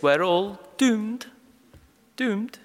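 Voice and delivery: slow, high, drawn-out Scottish wail of doom; Private Fraser Scottish accent. Retold or imitated. Preacher quoting the Dad's Army catchphrase, hammed-up Scottish doom. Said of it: "Manoah responds like Private James Fraser".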